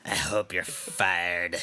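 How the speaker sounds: low, slow witchy croak; witchy old woman. Acted. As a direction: sink into a witchy drawl. Gladys spite.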